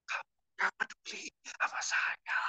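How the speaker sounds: a whispered mock quarrel line. Preacher mimicking a quarrelling spouse, whispered. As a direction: play the spouse's complaint in a hushed whisper. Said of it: "teriak sampai tetangga pun dengar".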